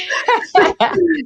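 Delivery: high burst of real laughter. Real, not acted. Guest laughing at the host's health question.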